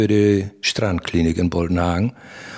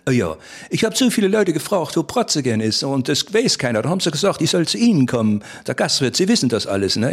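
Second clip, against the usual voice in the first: higher-pitched Berlin visitor voice; Berlin accent; 5 semitones higher than usual. Retold or imitated. Berliner tourist asking the innkeeper, in a higher Berlin voice. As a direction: play the lost Berliner asking for the way, high. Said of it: "kiekt er mir an und sagt".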